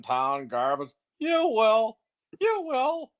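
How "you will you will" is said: high, rising Muppet-like goofy voice, climbing on the second; Harland Williams take. Retold or imitated. Doing a Harland Williams bit for the host, high and goofy. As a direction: squeal it up like a Muppet. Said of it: "It's a take on Harland Williams".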